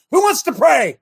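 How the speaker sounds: loud, high call out to the room; shouted call. Real, not acted. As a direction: rouse the congregation to volunteer. Pastor calling out to congregation.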